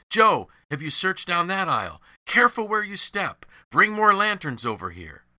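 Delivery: high, loud calls across a warehouse; shouting. A narrator's reading. Workmen calling to each other in the search, shouting. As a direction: call out urgently to fellow searchers. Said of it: "rang with shouts of men".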